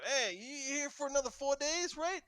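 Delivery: high, loud taunt at the prisoner; mock the prisoner while hitting him; guard taunts the donkey-headed prisoner. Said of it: "will smack the ... and go".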